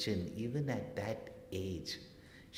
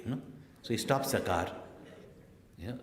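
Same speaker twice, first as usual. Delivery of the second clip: usual speaking voice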